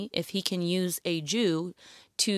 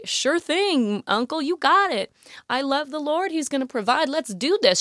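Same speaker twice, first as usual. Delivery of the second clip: higher, bright mock-cheerful yes; mock eager agreement; 7 semitones higher, 3 dB louder than usual. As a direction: play the too-easy eager volunteer. Imagined eager Esther answer.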